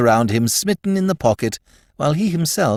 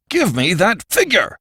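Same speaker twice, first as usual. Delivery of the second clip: high-pitched, forceful outburst; angry demand; 8 semitones higher, 3 dB louder than usual. A narrator's reading. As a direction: burst out with an impatient order. Repeated demand makes Archie start violently.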